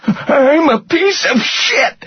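very high, slow pained whimper. A narrator's reading. Rudder forced to say it by Reacher, whimpering. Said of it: "Rudder whimpered".

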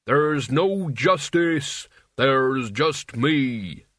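extremely slow, drawn-out booming words; ominous pronouncement. A narrator's reading. Mort overrules Albert's protest as Death would, ominous. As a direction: pronounce it like a doom, very slowly.

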